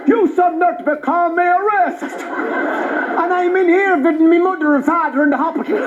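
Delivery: indignant mock Traveller rant; imitated Traveller accent. Comedian goes all in on Travellers, ranting in their accent. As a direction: pile up grievances in a Traveller accent. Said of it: "answered them in their accent".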